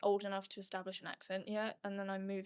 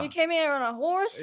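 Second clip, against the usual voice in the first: loud, high Southern accent; teasing question in a hammed-up Southern accent; 7 semitones higher, 10 dB louder than usual. Shown as a demonstration. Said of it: "Can you do, like, a Southern accent?".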